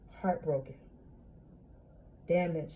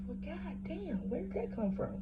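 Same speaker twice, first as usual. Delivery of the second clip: high, soft, stunned self-question; shocked; 11 semitones higher, 8 dB quieter than usual. Retold or imitated. Surprised at herself after snapping at the lady, shocked. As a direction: gasp at your own reaction. Retelling own shocked reaction.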